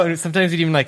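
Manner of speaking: usual speaking voice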